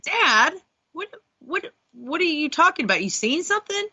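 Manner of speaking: retold surprise. Retold shock at dad's ghost story.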